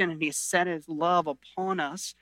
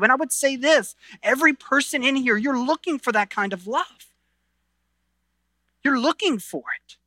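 higher, fervent sermon delivery; impassioned preaching; 6 semitones higher than usual. Acted. Pastor urging every person in the church, fervent. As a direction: drive it home to the room.

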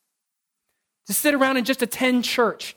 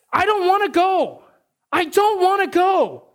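put-on whiny kid voice; 7 semitones higher than usual. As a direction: whine like a kid refusing karate. Son whining, again voiced.

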